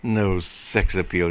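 usual speaking voice

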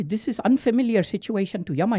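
ordinary delivery, nothing marked

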